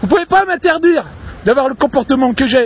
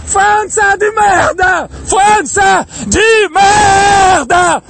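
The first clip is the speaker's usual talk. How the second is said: real furious shout; 5 semitones higher, much slower than usual. Real, not acted. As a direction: bellow it out with all the rage left. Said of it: "J'ai même pas la voix pour crier".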